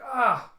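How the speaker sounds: a loud, high cry of pain; a sudden sharp yelp of pain; pained scream. Acted. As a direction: scream as the scrap cuts her. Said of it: "it's like".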